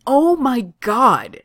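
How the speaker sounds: exasperated. A narrator's reading. Kaner bursting in on Johnny, exasperated. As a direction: drag it out in exasperation.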